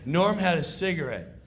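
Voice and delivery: ordinary talk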